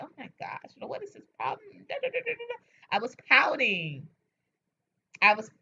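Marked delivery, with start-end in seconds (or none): 0.0-2.6 soft whiny pout. Retold or imitated. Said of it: "I was like".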